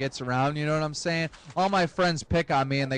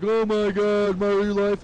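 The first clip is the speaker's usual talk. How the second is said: mock distraught wail, stretched at the end; wailing mom; 3 semitones higher, 5 dB louder, slower than usual. Mom bitching about son's murder case, theatrical wail. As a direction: imitate a mother melodramatically lamenting. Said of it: "My mom starts bitching up a storm".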